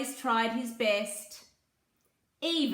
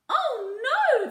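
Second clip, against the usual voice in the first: high loud cry of alarm; frightened cry; 14 semitones higher, 7 dB louder than usual. Acted. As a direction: cry out in fear. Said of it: "they cried".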